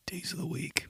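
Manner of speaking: breathy segment intro whisper; whispered title. Acted. Host whispering the segment name to listeners, hushed.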